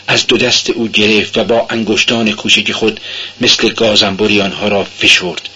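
ordinary delivery

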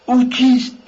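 the dread breaks out as he asks again; frightened cry. Acted. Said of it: "با صدایی بم و خفه گفت".

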